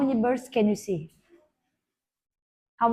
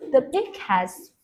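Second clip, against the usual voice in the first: slow, carefully spaced teacher model; slow model sentence; slower than usual. Shown as a demonstration. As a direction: spell it out slowly for a child.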